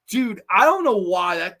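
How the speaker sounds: ordinary talk, nothing marked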